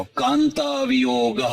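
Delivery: Kanta lifted high and drawn out slowly, then વિયોગ; slow emphatic recitation of the first word; stressed high on Kanta. Shown as a demonstration. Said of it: "તો એને પહેલાનો શબ્દ લખ્યો".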